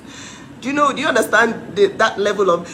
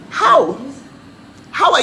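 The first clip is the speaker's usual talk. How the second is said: incredulous shout; 8 semitones higher, 4 dB louder than usual. Real, not acted. Mocking his system knowledge.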